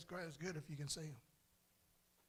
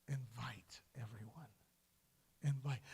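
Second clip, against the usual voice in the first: hushed, breathy whisper; whispered urging; 4 semitones lower than usual. Real, not acted. Preacher urging the church to invite everyone, whispered. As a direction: lean in and whisper it.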